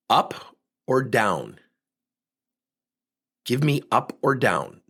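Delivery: slow, spaced "up or down"; slow, firm demand. Retold or imitated. Pressing the VP for a straight answer, slow and firm. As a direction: put each word down firmly.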